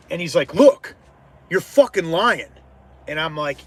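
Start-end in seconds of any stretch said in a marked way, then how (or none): 0.5-2.5 loud, high jump on the first word, then slower; re-enacted heated confrontation; angry accusation. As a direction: act out an irate customer. Acts out angry customer's accusation.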